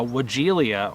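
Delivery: Wagelia said slowly as "wuh-JEE-lee-a"; variant pronunciation. Retold or imitated. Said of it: "Some of them call it".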